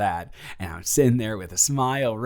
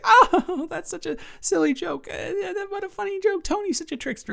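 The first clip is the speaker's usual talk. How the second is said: much higher, giggly mock voice of his wife. Mimicking his wife brushing off the prank, high and giggling. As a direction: laugh it off in a high, fake-cheery mimicry. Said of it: "she'll come home and just like".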